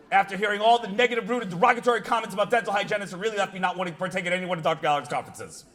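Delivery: very fast run-on recital; rushed complaint reading. Retold or imitated. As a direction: reel off the whole complaint in one breath. Racing through the negative review.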